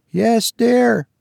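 remote voice. A narrator's reading. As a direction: answer as if from upstairs. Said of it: "came a remote voice".

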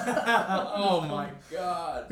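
slow, drawn-out laughing oh my God; real laughter through the words. Real, not acted.

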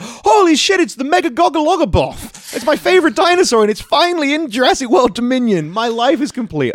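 high, loud gushing dinosaur geek; excited fan. Pretend. Imagined dinosaur fan gushing over his favorite, high and excited. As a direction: squeal like a superfan whose dream came true. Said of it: "was like".

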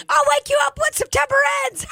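very high squeal; squeaky cartoon reply. Mister Hanky answering Tony, very high squeak. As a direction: chirp it in a tiny cartoon voice.

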